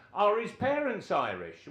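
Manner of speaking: high and loud, insistent question; manager's loud repeat. Retold or imitated. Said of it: "so Gordon Lee says".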